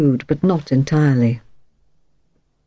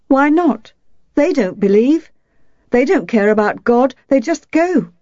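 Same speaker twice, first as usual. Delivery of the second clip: put-on much higher girl's voice, heated; a girl scoffing at churchgoers; indignant child; 8 semitones higher than usual. A narrator's reading. Indignant child argues with mother.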